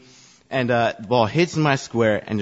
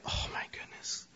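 dismayed whisper; 18 dB quieter than usual. Retold or imitated. Geeky kid in the mud whispers to himself, dismayed whisper. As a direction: breathe "Oh my goodness" out with no voice.

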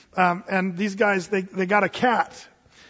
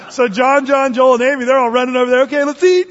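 louder, higher, eager storytelling; excited retelling; 6 semitones higher, 9 dB louder than usual. Retold or imitated. Kids rush over to eat the cat, eager and loud. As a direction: hurry it like the kids running over.

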